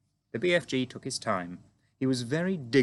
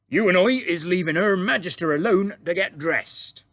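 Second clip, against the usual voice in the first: big booming giant voice, higher and louder; friendly giant; 9 semitones higher, 9 dB louder than usual. A narrator's reading. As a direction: boom it out like an eager, bumbling giant. BFG voiced in story.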